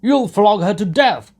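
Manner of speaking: loud, high cry; warning shout. A narrator's reading. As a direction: yell it from the crowd. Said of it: "shouted a third".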